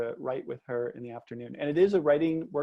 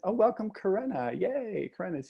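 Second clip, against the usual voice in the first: higher-pitched happy greeting; delighted welcome; 7 semitones higher than usual. Real, not acted. Host spotting Karena joining the session, delighted welcome. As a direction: greet a newcomer with a high, pleased lift. Greeting a late arriving attendee.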